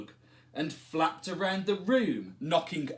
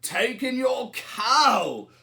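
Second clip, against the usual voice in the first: put-on wise old man; 7 semitones higher, 6 dB louder, slower than usual. A narrator's reading. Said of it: "said the wise old man".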